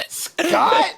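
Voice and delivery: high-pitched retold disbelief; astonished recognition. Retells recognizing cousin Scott.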